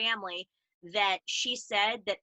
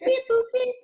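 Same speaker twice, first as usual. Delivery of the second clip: high, loud robot beeps; toy robot voice; 8 dB louder than usual. Pretend. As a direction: chirp it like a tiny toy robot. Robot sounds ending the show.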